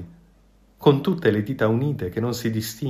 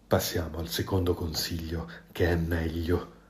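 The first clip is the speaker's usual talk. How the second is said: sly fox; 5 semitones lower, 9 dB quieter than usual. A narrator's reading. The hungry fox coaxing the goose, low and sly. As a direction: lower your voice, savour the next trick. Fox figure gives advice.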